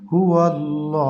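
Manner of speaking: slow chanted "হুয়াল্লাহ" with stretched final "aw"; long madd vowel. Shown as a demonstration. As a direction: hold the last vowel of "হুয়াল্লাহ" for a long beat. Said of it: "এক আলিফ টেনে পড়তে হয়".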